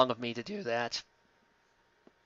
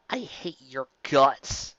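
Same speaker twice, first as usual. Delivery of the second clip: usual speaking voice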